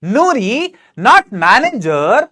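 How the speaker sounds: slow read with a small pause at the comma. Shown as a demonstration. Teacher showing a pause at the comma. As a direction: let the comma breathe. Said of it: "comma है इसलिए मैंने comma पढ़ने के लिए pause लिया".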